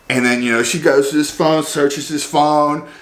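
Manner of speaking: ordinary talk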